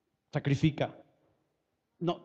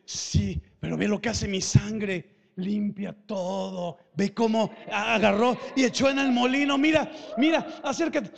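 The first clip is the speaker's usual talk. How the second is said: Señor Jesús; 6 dB louder than usual. Acted. Jesus showing off what his blood cleans, building to shouting. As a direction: start firm and stretched, build to excited calling. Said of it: "el Señor Jesús dice".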